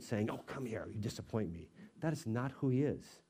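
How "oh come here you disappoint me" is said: soft, disappointed scolding; guilt-tripping. Retold or imitated. Manipulative God rubbing it in, quietly scolding. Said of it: "rub it in your face to manipulate".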